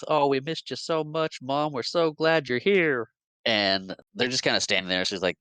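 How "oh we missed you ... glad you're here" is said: gushing, higher, slow sugary welcome; hammed-up fake-sweet greeting; laying it on thick. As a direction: overdo the love, syrupy sweet. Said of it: "lay it on really thick with".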